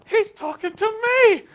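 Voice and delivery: high, loud and slow, astonished; dawning shock; amazed. Retold or imitated. Pastor realizing a kid called him sir, astonished.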